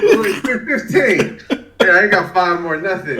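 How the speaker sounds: loud, high mock protest; retold indignant outburst; exasperated refusal. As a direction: push back hard at the trainer, half laughing. Acts out refusing trainer's count.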